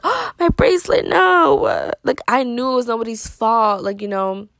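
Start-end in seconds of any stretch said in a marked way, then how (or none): 0.0-1.9 gasp, then a high, loud "no"; dismayed cry. Retold or imitated. Girl retelling losing her bracelet on the ride, high dismayed cry. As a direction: squeal in horror at the lost bracelet. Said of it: "I'm like, gasps".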